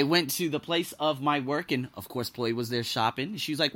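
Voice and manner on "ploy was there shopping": ordinary delivery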